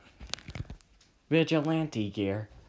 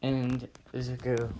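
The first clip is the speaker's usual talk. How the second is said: ordinary delivery, nothing marked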